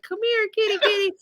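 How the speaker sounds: high, singsong calling for a kitty; sugary kitty-kitty call; sweet cat call. Retold or imitated.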